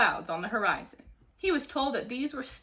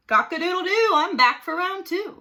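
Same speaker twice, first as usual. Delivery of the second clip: crowed much higher and louder; hollered; 8 semitones higher, 7 dB louder than usual. A narrator's reading. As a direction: holler it like a rooster's challenge. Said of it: "hollered Mike".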